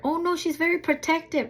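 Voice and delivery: high, urgent warning call; retold warning. Dog owner warning an approaching stranger, high warning call. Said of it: "I'm telling her".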